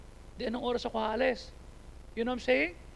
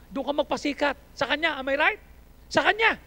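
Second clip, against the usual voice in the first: normal talking voice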